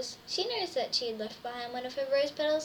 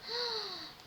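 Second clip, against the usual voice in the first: quiet gasp of delight; soft gasp; 7 semitones higher, 8 dB quieter than usual. Acted. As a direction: gasp at the dress. Dress found in wardrobe.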